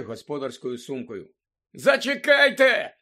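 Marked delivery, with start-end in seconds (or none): 1.7-3.0 high, loud, drawn-out call; calling after, Гаррі. A narrator's reading. Boy to the departing woman, calling after. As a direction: holler after someone walking away. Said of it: "гукнув їй вслід Гаррі".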